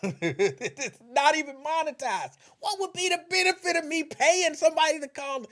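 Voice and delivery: high, loud disbelieving outburst; incredulous shout. Real, not acted. To the audience about the paid caller, incredulous shout. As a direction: yell it in laughing disbelief.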